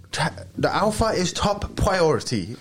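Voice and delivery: schoolboy copying a tough-guy influencer, high and slow; mock alpha kid. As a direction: parody a boy preaching alpha talk. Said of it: "He talks like that".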